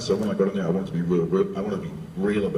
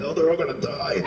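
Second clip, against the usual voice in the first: mock panic; 9 semitones higher than usual. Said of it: "I was going".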